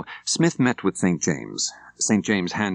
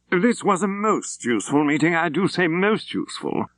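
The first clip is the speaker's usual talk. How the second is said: higher, prim clipped-free gentleman's voice; fussy English solicitor; 10 semitones higher than usual. Acted. Solicitor Woodcock thanking Reverend Apt, a higher fussy voice. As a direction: play the pleased little solicitor.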